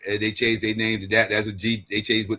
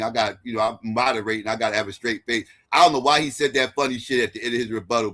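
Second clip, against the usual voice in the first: usual speaking voice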